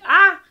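loud, high "ah" of being fed up; sudden re-enacted yell of exasperation; exasperated cry. Narrator fed up at the third strike, exasperated cry. Retold outburst ending friendship.

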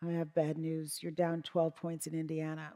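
a hint of a grim advisor; grim news. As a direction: deliver it flatly and softly. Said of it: "and said".

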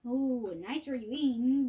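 ordinary talk

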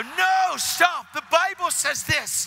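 high, loud insistent objection; urgent protest. Retold or imitated. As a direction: cut him off at full pitch. He protests against the praise.

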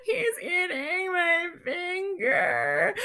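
high, slow, wailing cry; crying out in pain. Acted. Penelope wailing as the goldfish bites. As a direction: wail it while the finger is bitten.

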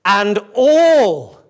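"all" drawn out and loud; big, emphatic "all"; stress on "all". Shown as a demonstration. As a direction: swell hard on "all", then let it fall. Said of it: "another word worthy of highlighting".

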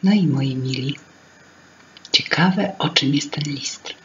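ordinary delivery

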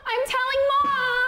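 whiny tattling little sibling. Pretend. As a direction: shout it like a kid running to Mom. Kids fighting over toy.

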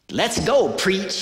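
loud, high rallying call; eager urging. Retold or imitated. Kickboxer friend urging the preacher along, eager and loud. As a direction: imitate a fired-up buddy raring to go.

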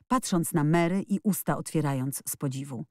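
ordinary delivery, nothing marked

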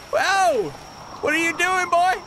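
high squealing laughter at the nasty guy; giggly mocking. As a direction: cackle at him as he runs.

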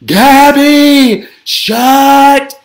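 high, very loud, stretched scream. A narrator's reading. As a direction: scream at the singer to stop. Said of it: "Every living creature in the forest screamed".

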